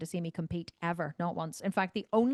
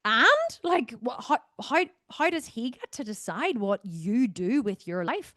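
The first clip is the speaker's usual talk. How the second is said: much higher, louder disbelief; incredulous outburst; 3 semitones higher, 4 dB louder than usual. Real, not acted. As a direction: burst out in disbelief at the idea. Indignant about controlling husbands.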